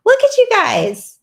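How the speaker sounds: high, loud delighted exclamation. Real, not acted. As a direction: beam at the viewers. Delighted at viewers' comments.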